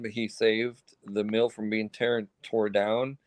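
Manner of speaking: ordinary talk, nothing marked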